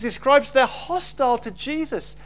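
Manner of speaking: usual speaking voice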